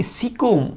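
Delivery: সিকুম said softly, as one word si-kum; mnemonic word. Shown as a demonstration. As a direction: say সিকুম clearly as a memory word. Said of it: "সি সি এম না বলে আমরা বলবো কি?". (সিকুম শব্দটা নরম করে স্মৃতিসূত্র হিসেবে বলা)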